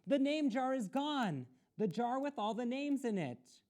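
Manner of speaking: high loud urgent announcement; shouted. A narrator's reading. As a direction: shout the news to the teacher. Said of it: "Ralph shouted at him".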